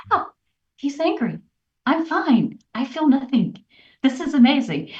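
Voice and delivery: amazed delight. Retold or imitated. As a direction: burst out in astonished joy at feeling calm. Retold joyful discovery during argument.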